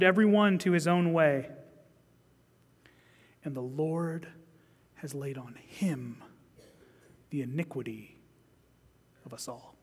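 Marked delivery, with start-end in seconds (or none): 3.4-9.7 dropping to a low whisper; hushed reverence. Real, not acted. Preacher reading Isaiah, hushed and reverent. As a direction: let it sink into awed hush. Solemn scripture climax.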